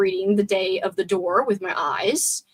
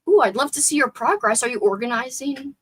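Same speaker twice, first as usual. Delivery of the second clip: normal talking voice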